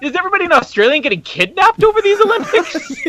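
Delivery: very high, laughing incredulous question; mock alarm. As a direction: squeal it in comic disbelief. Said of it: "And I'm like".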